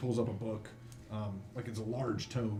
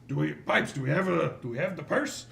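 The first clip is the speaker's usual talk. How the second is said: raised, louder questioning call; higher-pitched constable's holler; dwarf calling his helper; 5 semitones higher, 6 dB louder than usual. Acted. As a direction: call to the back for the purse. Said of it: "he goes".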